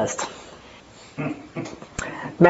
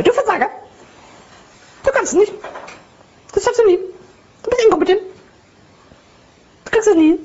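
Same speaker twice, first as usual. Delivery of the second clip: much higher squeaky cartoon voice; like helium or a tape at double speed; Mickey Mouse voice; 13 semitones higher, faster than usual. Shown as a demonstration. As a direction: make the inner critic sound ridiculous with a high cartoon voice. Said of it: "diese Mickey Maus Stimme".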